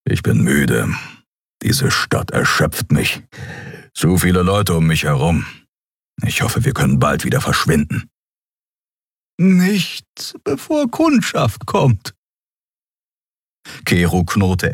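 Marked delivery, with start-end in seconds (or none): 0.0-8.1 lightly lowered weary creature voice; a hint of a tired beast; big creature sick of the crowded city; tired Kero. A narrator's reading. 9.4-12.1 much higher, slow teasing reply; Xea's retort. A narrator's reading. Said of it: "Xea replies".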